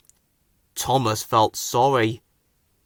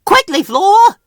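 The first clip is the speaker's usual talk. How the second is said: much higher, louder urgent call; whistled loudly; 10 dB louder than usual. A narrator's reading. Thomas rushing Flora away, loud and high. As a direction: hurry her along. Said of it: "he whistled loudly".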